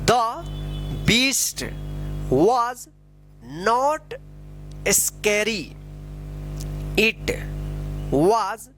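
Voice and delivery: slow reading with a long pause after each word; deliberate, isolated words, firmly pronounced; word-by-word model reading. Shown as a demonstration. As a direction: read each word out separately for learners to repeat.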